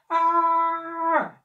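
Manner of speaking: long, loud, high yell of pain; high scream as if bitten; drawn-out acted yelp. A narrator's reading. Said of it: "he yelped".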